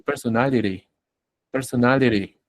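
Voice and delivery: "personality" ending "-lery", t tapped; flapped T. Shown as a demonstration. Teacher offers students the alternative, flapped T. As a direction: soften the t of "personality" into a tap. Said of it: "pero puedo decir".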